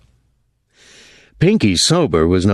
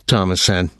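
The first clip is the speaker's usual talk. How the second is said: ordinary delivery, nothing marked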